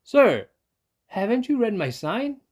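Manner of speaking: high, loud shout through the door; shouted refusal. Acted. Dentist shouting down at the fox outside, high and loud. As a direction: shout him away from the door. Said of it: "Doctor DeSoto shouted".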